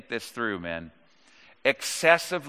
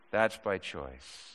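softer, slower echo of that's by choice; quiet deliberate repeat; 6 semitones lower, 8 dB quieter, slower than usual. Shown as a demonstration. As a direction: let it sink in, quietly. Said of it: "if I'm being redundant, that's by choice".

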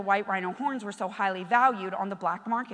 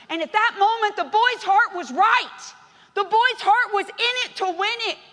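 loud, high preacher's cry; full-force preaching; 10 semitones higher, 10 dB louder than usual. Acted. Pastor exhorting the church to celebrate, loud and high. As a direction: preach it out with fire.

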